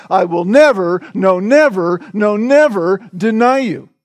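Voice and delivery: each NEVER hit hard with a swooping fall; mounting, resolute denial in repeated punches; emphatic vow. Acted. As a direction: vow it insistently, leaning on every "never". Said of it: "could not have said that more emphatically".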